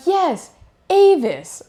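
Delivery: "Yes, Avis" said slowly and high, every syllable drawn out; slow patient worker. Retold or imitated. Worker slowly repeats the name to a confused driver, high and drawn out. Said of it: "And he was like".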